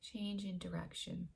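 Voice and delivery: hushed repeat of the title; soft echo. Shown as a demonstration. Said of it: "The first one is change in direction".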